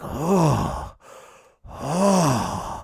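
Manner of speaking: lightly voiced somber anchor "oh"; a hammed-up grave sigh; mock anchor sighs. Mocking how the anchor sounds, mock somber. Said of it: "who talks like that".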